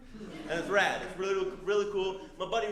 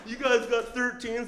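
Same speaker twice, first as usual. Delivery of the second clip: hopeful loud ask; 8 semitones higher, 6 dB louder, slower than usual. Retold or imitated. Asking shop for big shoes.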